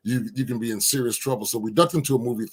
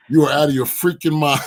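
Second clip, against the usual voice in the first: louder, indignant retort at a friend; retold outburst; 3 semitones higher, 7 dB louder than usual. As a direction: fire it back in disbelief. Retelling refusal of nickname.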